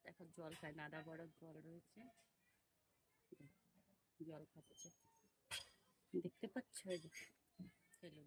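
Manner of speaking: hushed so the neighbours won't scold; talking very softly. Real, not acted. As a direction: keep it barely audible, not to disturb. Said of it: "আস্তে আস্তে কথা বলছি।". (খুব আস্তে, ফিসফিস করে)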